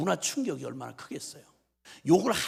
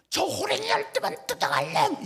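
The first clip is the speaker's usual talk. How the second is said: high-pitched mock curse, imitating an exasperated mother; mother shuddering with fury; 14 semitones higher than usual. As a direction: hurl a hammed-up curse after a fleeing son. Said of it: "우리 엄마가 막 치를 떨면서". (치를 떠는 엄마 흉내, 높은 욕설)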